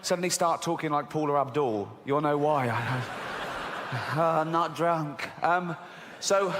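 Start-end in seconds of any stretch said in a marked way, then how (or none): 2.7-4.1 laugh. Real, not acted.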